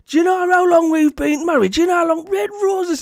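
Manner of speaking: high, loud indignant shouting; Big Davey. Retold or imitated. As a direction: shout in mock outrage like an appalled husband. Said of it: "He says".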